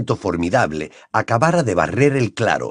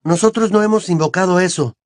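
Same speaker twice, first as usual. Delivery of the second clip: high-pitched protest; exclaimed denial; 8 semitones higher than usual. A narrator's reading. Harry pointing at the skull before his accuser, exclaiming. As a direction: protest your innocence. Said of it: "exclamó Harry".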